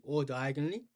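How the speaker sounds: ordinary talk, nothing marked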